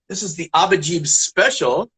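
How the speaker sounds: normal talking voice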